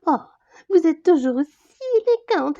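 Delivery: usual speaking voice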